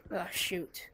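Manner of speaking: hushed urgent whisper. Real, not acted. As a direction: breathe it out unvoiced. Whispered alarm.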